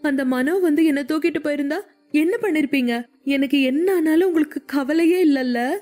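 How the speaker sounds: high-pitched, acted crying complaint; hurt, weepy voice pitched well up; tearful reproach. A narrator's reading. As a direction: plead and accuse as if through tears. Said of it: "அழுது கொண்டே".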